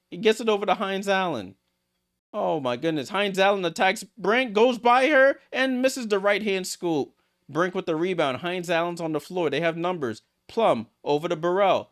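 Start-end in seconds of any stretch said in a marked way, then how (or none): 3.1-7.1 climbing higher and louder on the drive; excited call. Real, not acted. Streamer calling a drive to the rim, rising excitement. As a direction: lift with the attack, then ease off.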